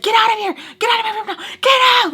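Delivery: high-pitched screamed order; retold screaming. Re-enacting herself screaming at him to leave her room. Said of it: "I'm, like, screaming at him".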